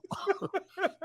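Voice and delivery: a sudden high laugh; high burst of laughter. Real, not acted.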